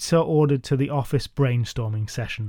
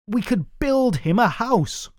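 eager; 9 semitones higher than usual. A narrator's reading. Advisor reveals the harebrained plan.

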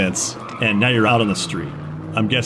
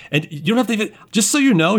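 nervous captive; 9 semitones higher, faster than usual. Acted. Captive pleads his case to runners.

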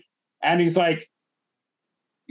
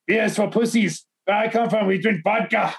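higher-pitched, put-on Russian accent; proud Russian scoffing in thick accent; 6 semitones higher than usual. Russian turns down his beer offer, scornful Russian accent. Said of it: "this, like, fucking Russian dude".

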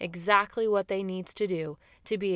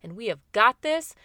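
loud, overconfident "I've got this"; cocky self-assurance; 8 dB louder than usual. Retold or imitated. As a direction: brush it off like you've got it handled. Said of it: "Even if you think".

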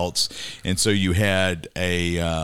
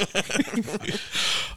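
breathy laugh; 14 semitones higher, 6 dB quieter than usual. Real, not acted. Laughing at Froggy.

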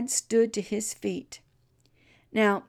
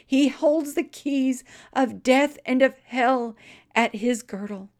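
fervent prayer; 4 semitones higher, 4 dB louder than usual. Retold or imitated. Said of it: "this is what he prayed".

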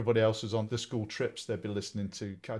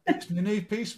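put-on high pleading kid; 11 semitones higher, 6 dB louder than usual. Voicing young self to mum.

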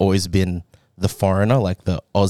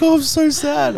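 high mock-pouty Oh, I'm so sad. Imitating her cousin missing the snow, high mock pout. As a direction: ham up the disappointment. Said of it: "She was like".

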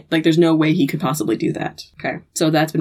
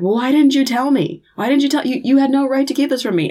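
desperate outburst; 8 semitones higher, faster than usual. Retold or imitated. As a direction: throw the hurt back at him, voice climbing. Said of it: "desperate, upset".

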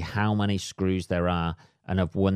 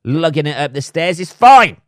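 higher, louder mock cheerfulness, peaking on fine; sarcastic farewell; 6 semitones higher, 7 dB louder than usual. Ranting vlogger mocks movers who refused the stairs, in sarcastic high cheer. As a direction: sing it sweetly while seething underneath.

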